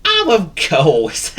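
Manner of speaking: high, loud, slow realisation; laughing cartoon dog, drawn out; forgetful dog. A narrator's reading. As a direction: exclaim it as the penny drops.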